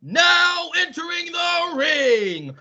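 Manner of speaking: booming ring announcer; grand entrance announcement. Pretend. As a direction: build the hype like a wrestling announcer. Ring announcer introducing show.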